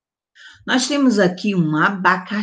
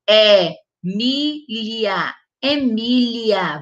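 Emília said very slowly, syllables apart; slow teaching repeat; much slower than usual. Shown as a demonstration. Teacher repeating the name for pupils, very slow. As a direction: drag out Emília for the class. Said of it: "eu usei o E maiúsculo".

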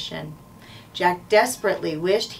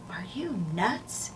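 slow, soft, incredulous whisper; 8 dB quieter, slower than usual. A narrator's reading. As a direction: whisper it slowly in disbelief. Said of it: "Jack whispered".

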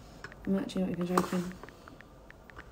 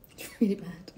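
whispered, half to herself; whispering; 3 dB quieter than usual. Real, not acted. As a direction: let it out as a dismayed whisper. Muttering about bad makeup.

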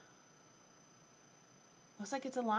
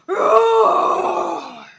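long, loud, higher lion roar; ferocious roar; 7 semitones higher, 18 dB louder than usual. A narrator's reading. Pupil facing the dog, ferocious loud roar. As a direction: roar with full force. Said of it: "I let out a ferocious roar".

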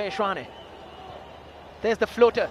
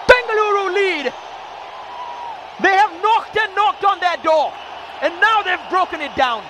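goal shout; 6 semitones higher than usual. Real, not acted. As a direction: yell the header goal. Header goal called live.

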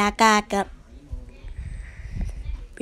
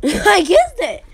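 laughing; 8 semitones higher, 7 dB louder, slower than usual. Real, not acted. Laughing at own card guess.

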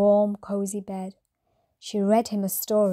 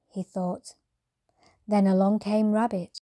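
usual speaking voice

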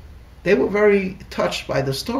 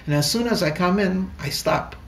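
normal talking voice

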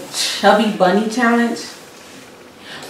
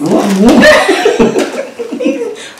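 muffled laugh; 7 dB louder than usual. Real, not acted. Laughs with mouth full.

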